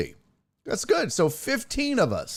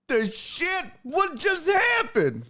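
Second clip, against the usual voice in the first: astonished shout; 12 semitones higher than usual. Real, not acted. Reacting to the sub timeout.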